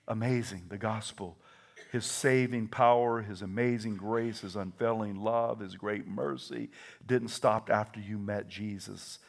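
usual speaking voice

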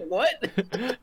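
very high-pitched mock disbelief; bewildered, acted. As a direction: squeak it out in comic bafflement. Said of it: "I was just like".

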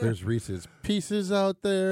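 slow, drawn-out teasing list. Acted. Co-host teasing a friend about baiting squirrels, slow and teasing. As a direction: savour each snack name slowly.

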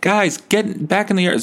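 high-pitched mock excitement over cake; excited coworkers. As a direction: squeal it like coworkers spotting free cake. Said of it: "other people are like".

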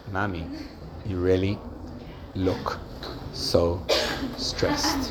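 soft, low and halting sympathy; caring tone of voice. Shown as a demonstration. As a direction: say it gently, pausing between the words. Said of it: "It's tone of voice".

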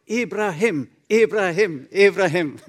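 loud, high rhythmic chant of Ibrahim; crowd shouting. Retold or imitated. The whole hall roaring the name, loud chant. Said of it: "står hele salen og roper".

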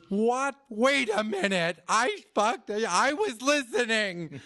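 a hurt student snapping back; indignant student. Retold or imitated. Student protests the criticism.

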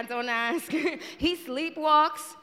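ordinary delivery

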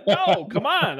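words through a big laugh; laughing hard. Real, not acted. Cohost still laughing at partner after the show. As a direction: still cracking up, barely getting it out. Host cracking up after episode.

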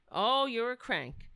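normal talking voice

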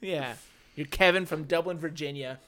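usual speaking voice

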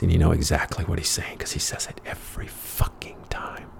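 hushed whisper aside. Real, not acted. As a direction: lean in and whisper it. Aside about his habit.

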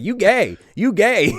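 high, loud jeer; imitated taunting kids. Little kids circling a woman, jeering at her. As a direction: mimic children's mocking chant. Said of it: "little kids circling around her".

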